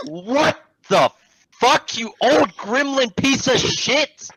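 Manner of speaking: high, loud, drawn-out rant; outraged outburst. Retold or imitated. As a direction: explode in exaggerated disbelief, stretching the words. Outraged reaction to customer's retold answer.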